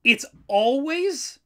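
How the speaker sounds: real outraged incredulity; incredulous. Real, not acted. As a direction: repeat it in utter disbelief. Incredulous repeat of the rule.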